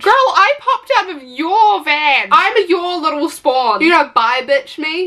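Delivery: incredulous rant. Real, not acted. Ranting at the mother.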